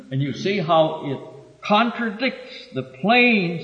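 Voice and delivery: normal talking voice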